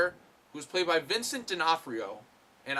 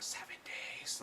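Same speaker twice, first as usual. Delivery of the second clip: creepy whisper; 13 dB quieter, slower than usual. Retold or imitated. Retold creepy phone call.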